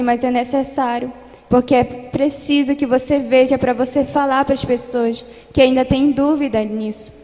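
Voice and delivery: preaching testimony. Acted. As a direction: preach the vision to the congregation with rising force. Testimony preached with growing force. (testemunho pregado com fervor crescente)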